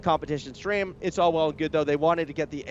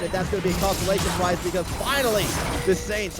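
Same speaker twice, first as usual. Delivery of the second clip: live play-by-play; 3 semitones higher, 3 dB louder than usual. Real, not acted. Caster calling the final fight as the base falls, excited play-by-play. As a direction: call the fight fast and loud as it unfolds.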